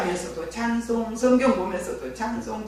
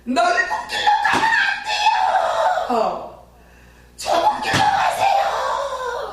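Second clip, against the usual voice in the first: much higher, slow wailing cry to her father; crying shout; 4 dB louder, slower than usual. Retold or imitated. Daughter wailing to her dying father, crying shout. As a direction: sob and shout the plea, stretching every word. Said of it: "이렇게 소리를 치고 그랬어요".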